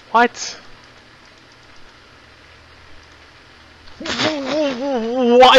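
shrieking disbelief at the lights; high frustrated outburst. Real, not acted.

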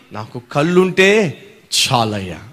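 drawn-out slow plea stressing కళ్ళుంటే; slow emphatic plea. Shown as a demonstration. Preacher to congregation, slow stressed plea. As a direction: stretch every word of the plea. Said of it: "జాగ్రత్తగా వినాలి".

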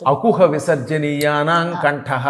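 slow, drawn-out Sanskrit rule recital; sutra recitation. Shown as a demonstration. Guru cites a Sanskrit grammar rule to the host, slow recitation. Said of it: "సూత్రం ఏమిటి".

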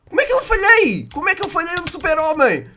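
very high-pitched, loud disbelief; squealed, exasperated protest; incredulous outcry. Real, not acted. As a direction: cry out in comic outrage at your own miss. Missed his shot in game.

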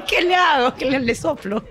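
high put-on helpless voice; baffled cleaning lady. Cleaning lady asks what to do, comically baffled.

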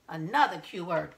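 ordinary delivery, nothing marked

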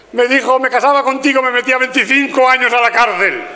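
high-pitched, hammed-up sobbing husband; llanto a lágrima viva fingido. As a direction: wail it like a tearful husband, for laughs. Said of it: "Pero a lágrima viva, así, mirar". (llorando a lágrima viva, exagerado)

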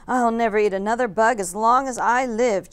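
loud, happy cheer. A narrator's reading. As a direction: cheer with a mouthful of mango. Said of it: "cheered Stellaluna".

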